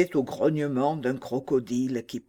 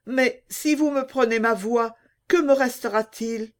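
put-on higher, sad pleading girl voice; sad young mermaid; 8 semitones higher than usual. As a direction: ask sadly as a frightened mermaid. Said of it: "demanda tristement la pauvre petite sirène".

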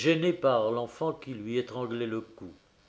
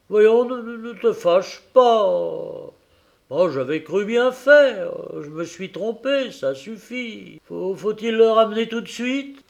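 higher, halting, placating plea; stammering plea; 7 semitones higher, 7 dB louder than usual. A narrator's reading. Meek husband pleading with his angry wife, stammering. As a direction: soothe her, tripping over words. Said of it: "Le marinier promit tout ce qu'elle voulut".

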